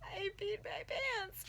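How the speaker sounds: high, slow, shaky admission; tearful confession. Retold or imitated. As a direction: confess it through tears. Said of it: "I'm like".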